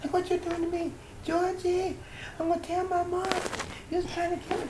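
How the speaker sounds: mock a little boy crying foul; retold boy screaming. Boy knocked down by the hose, mock high whine at Georgie. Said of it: "he starts screaming".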